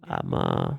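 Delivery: hushed ASMR-style call-out, partly whispered. Retold or imitated. Gamer jokingly whispering call-outs to his lobby, ASMR style. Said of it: "start doing call-outs in A S M R".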